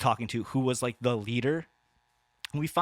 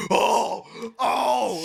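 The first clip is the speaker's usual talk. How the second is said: high, loud mock crowd gasp; crowd's shocked oohing; 9 semitones higher, 6 dB louder than usual. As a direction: imitate onlookers reacting to a diss. Imitating the crowd's shocked reaction.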